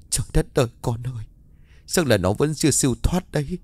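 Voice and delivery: high-pitched, grieving lament; stunned, tearful. A narrator's reading. Mother learns her dead son was seen, stunned and tearful. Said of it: "Bà Sáu liền thẳng thốt".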